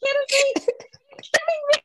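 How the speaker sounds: squealing mock disbelief; mock outrage. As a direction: play shocked disbelief.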